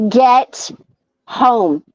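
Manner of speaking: slow, lightly voiced stern dad; each word set apart, mild dad-style order. Husband ordering his wife home, mild dad voice. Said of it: "dad voice kicked in".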